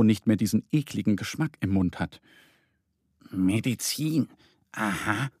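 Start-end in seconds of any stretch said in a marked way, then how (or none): 3.3-5.3 murmuring. A narrator's reading. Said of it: "murmelt Mo".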